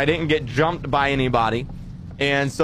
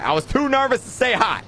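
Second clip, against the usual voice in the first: repeated much higher for emphasis; plain but much higher; 8 semitones higher, faster than usual. Shown as a demonstration. Streamer repeating to chat, much higher. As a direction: say it again, pitched well up.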